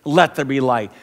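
ordinary talk

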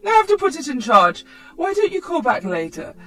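loud, high, rushed fake excuse; flustered mum. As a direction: grandmother hurrying to hang up. Mum hurriedly cuts call off.

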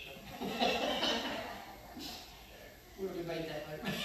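chuckling through the words; laughing. Real, not acted. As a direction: laugh it off fondly.

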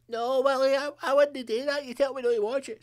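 a put-upon wife pleading innocence; meek wife. Retold or imitated. Wife voiced defending herself.

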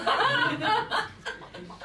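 a high, bursting laugh at own joke; high cackle. Real, not acted. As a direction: crack up at the punchline.

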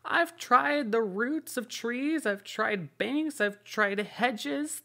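very high, slow, whining bird voice; fretful pigeon. A narrator's reading. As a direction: moan it like an exhausted pigeon. Pigeon voice, complaining.

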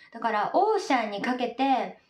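ordinary talk, nothing marked

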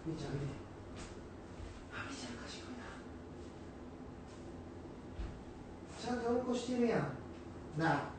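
high, soft voice for the dog; dog talk. Real, not acted. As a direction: coo at the puppy. Talking to his dog. (犬に話しかける高く柔らかい声)

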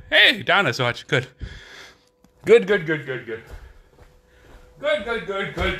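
ordinary delivery

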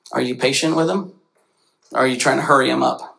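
ordinary talk

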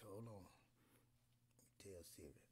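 hushed aside to the phone; quick whispered aside. Real, not acted. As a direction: whisper it so Siri won't hear. Aside while phone listens.

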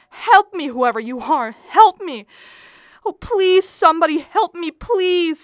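loud, high pleading cries; fainting wife crying out for help; desperate cry for help. Acted. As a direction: beg anyone to help.

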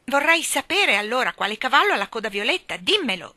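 loud, high, indignant demand; shouting. A narrator's reading. Michael arguing over the horse's tail, shouting. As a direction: raise your voice in argument. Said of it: "gridò lui".

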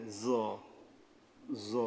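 জ pronounced thick from the tongue tip at the teeth; thick, heavy articulation. Shown as a demonstration. Teacher showing where the letter sits, thick, heavy জ. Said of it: "মোটাভাবে আদায় হবে".

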